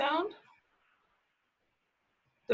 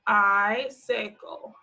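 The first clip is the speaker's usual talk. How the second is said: slow sounding out; much slower than usual. Shown as a demonstration. Teacher sounding out "Icicle" slowly for kids. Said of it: "our last one is icicle".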